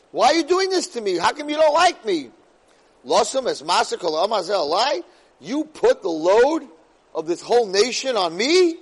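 high, loud wounded plea; pleading complaint. Acted. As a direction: plead like a hurt leader to God. Moshe complaining to God.

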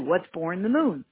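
ordinary talk, nothing marked

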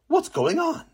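woman's voice. Acted. Fish seller asking what's happening, high and light. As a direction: lift it up into a curious wife.

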